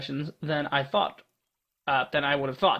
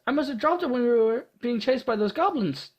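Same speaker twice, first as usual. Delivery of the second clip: put-on high, anxious girl voice; a young adventurer fretting; young heroine voice; 7 semitones higher than usual. Voices Ada in a story.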